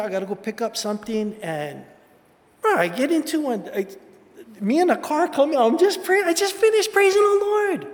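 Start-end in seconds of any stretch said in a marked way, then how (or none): 2.6-7.9 rising to an excited near-shout as the car appears; excited retelling. Retold or imitated. Pastor reliving a parking-lot scare for the congregation, excited and loud. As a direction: voice climbing high and loud in excitement.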